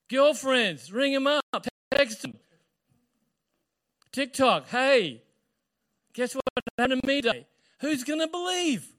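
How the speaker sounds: high, loud rush of news to friends; excited announcing. Acted. Young woman telling her girlfriends, excited announcing. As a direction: call out to all your friends, pitched high. Acting Mary spreading the news.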